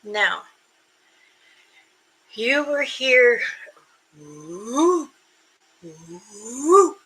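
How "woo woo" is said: a couple of hooting woos; imitated low hoops. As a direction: hoot like a creature calling in the woods. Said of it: "Those are low tone hoops".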